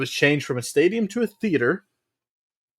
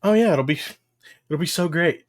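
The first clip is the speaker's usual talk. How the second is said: higher-pitched mock enthusiasm; sarcastic; 5 semitones higher than usual. Real, not acted. Host answering news of a return next year, sarcastic. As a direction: gush sarcastically about how great it will be. Mocking next year's show after calling it bad.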